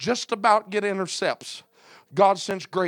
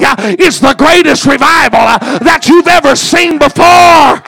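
loud revival sermon, building to a shout; shouted preacher cadence, key words stretched; full-force preaching; 4 semitones higher, 15 dB louder than usual. Real, not acted. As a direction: drive each phrase home with a preacher's "uh".

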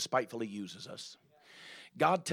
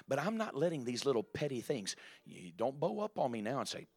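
ordinary talk, nothing marked

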